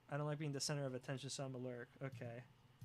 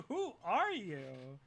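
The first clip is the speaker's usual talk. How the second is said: very slow mock question; much slower than usual. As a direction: drag out who are you.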